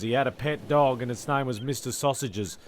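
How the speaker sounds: usual speaking voice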